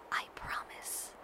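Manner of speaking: very soft, slow whisper. A narrator's reading. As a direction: breathe it into his neck. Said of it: "I whisper into the crook".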